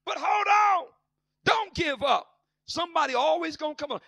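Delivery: shouted high exhortation; rally them with a shout; preaching at full force. Acted. Preacher telling the church not to give up, shouting.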